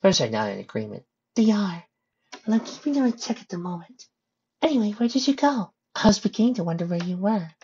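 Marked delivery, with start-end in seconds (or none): 1.3-7.5 high, gentle character voice. Acted. Pony asking Rainbow where she went, high gentle voice. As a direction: answer in a higher pony voice.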